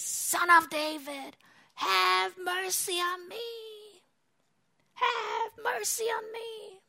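high, long-drawn cry for mercy; acted beggar wailing from afar; imitated desperate scream. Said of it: "he screams on top of his lungs".

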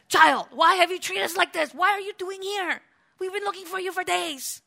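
high, upset scolding from the parents; mock angry parent. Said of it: "they lost their temper and said".

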